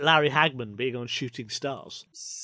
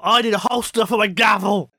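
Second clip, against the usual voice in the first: loud, higher comic shout; 6 semitones higher, 7 dB louder than usual. Real, not acted. Host shouting about his dropped gavel, loud. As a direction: belt it out in mock outrage.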